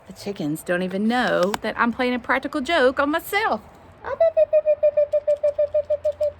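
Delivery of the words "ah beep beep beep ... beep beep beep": high, loud, rapid-fire beeps; chicken call. Real, not acted. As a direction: call the hens with shrill staccato beeps. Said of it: "That's how I say, "Come here, chickens".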